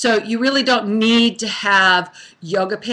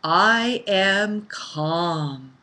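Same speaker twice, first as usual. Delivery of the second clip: very slow, calm and quiet; calm affirmation; 3 semitones lower, 4 dB quieter, much slower than usual. Shown as a demonstration. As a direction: let each word settle slowly. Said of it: "Try that with me mentally or out loud".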